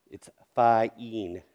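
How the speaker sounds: "fine" split into fah-een; over-articulate the long i in "fine"; exaggerated corrected vowel. Shown as a demonstration. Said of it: "I'm gonna say".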